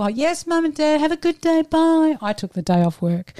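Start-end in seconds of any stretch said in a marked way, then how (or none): 0.0-2.2 put-on high sing-song innocent child; sweet as pie, hiding a plan; overly sweet goodbye. Fake sweet goodbye to parents.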